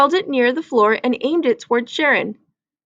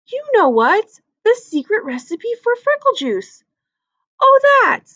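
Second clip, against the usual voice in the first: put-on girl voice; 10 semitones higher than usual. Kids arguing over recipe.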